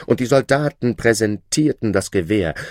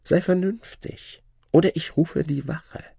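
high, lordly and condescending; haughty shadow; 10 semitones higher than usual. Acted. The Shadow lording it over the learned man, haughty high voice. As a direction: talk down to your old master.

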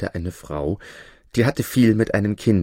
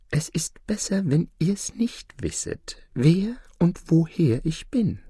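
higher-pitched devil voice; 8 semitones higher than usual. Acted. Devil refusing the judge his name, high-voiced and evasive.

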